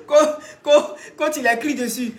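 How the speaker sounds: stammered start through real laughter; laughing while talking. Real, not acted. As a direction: try to speak while still cracking up.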